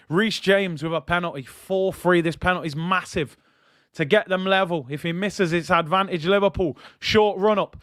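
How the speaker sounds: usual speaking voice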